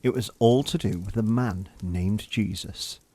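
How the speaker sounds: ordinary delivery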